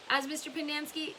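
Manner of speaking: usual speaking voice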